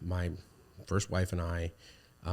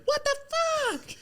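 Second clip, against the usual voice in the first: put-on very high little-boy squeak; imitating his son; 4 dB louder than usual. Dad mimicking his son copying the swear, tiny high voice. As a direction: pitch it up like a five-year-old. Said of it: "I'll hear him be like".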